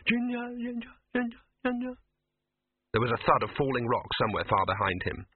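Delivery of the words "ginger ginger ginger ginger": high, fading echo repeated; the call bouncing back and fading; echoing name. A narrator's reading. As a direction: let the name echo away down corridors. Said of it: "He could hear it bouncing away".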